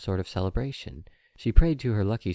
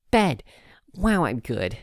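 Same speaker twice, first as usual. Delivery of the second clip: triumphant little reader; filly proud of reading a word; 5 semitones higher, 8 dB louder, slower than usual. Acted. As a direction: shout then a whispered boast.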